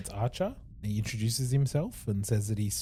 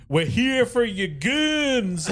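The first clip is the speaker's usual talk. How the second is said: much higher, slow Western sheriff twang with a laugh; sheriff's twang; 12 semitones higher, 5 dB louder, much slower than usual. Retold or imitated. Host imitating the Tombstone sheriff, Western twang. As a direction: drawl it like a cowboy sheriff from a reenactment. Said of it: "that similar sort of twang in his voice".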